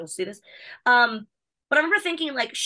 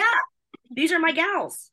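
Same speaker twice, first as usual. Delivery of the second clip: excited; 8 semitones higher, 4 dB louder, much slower than usual. Real, not acted. Gushing about her friends.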